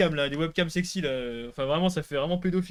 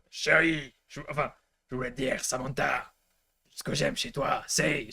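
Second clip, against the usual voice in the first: rock voice love declaration; slower than usual. Shown as a demonstration. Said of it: "d'une voix rock".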